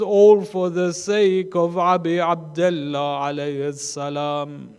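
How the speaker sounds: loud, slow grieving recitation; mourning recitation. Acted. Grieving mother reciting poetry at her sons' graves, loud and slow. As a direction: declaim her lament like an elegy.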